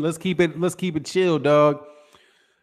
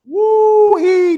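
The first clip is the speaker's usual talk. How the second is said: high "Woo-hee" whoop, much higher and louder; excited whoop; 6 dB louder than usual. Real, not acted. Streamer whoops at the boxing feud, high excited hoot. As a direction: let out a high hooting whoop. Reacting to a heated boxing argument.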